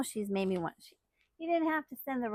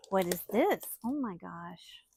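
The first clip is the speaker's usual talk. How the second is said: normal talking voice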